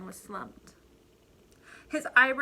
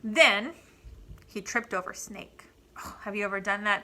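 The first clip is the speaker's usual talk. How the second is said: ordinary delivery, nothing marked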